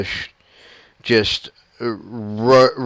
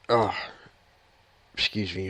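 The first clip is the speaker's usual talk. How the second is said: with heartburn; 7 dB quieter, faster than usual. Real, not acted.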